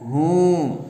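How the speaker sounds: short hum of pain; sick man's groan. Acted. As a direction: groan through the ache. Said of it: "درد کی آواز نکالتے ہوئے کہتا ہے".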